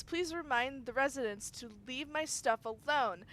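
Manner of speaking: usual speaking voice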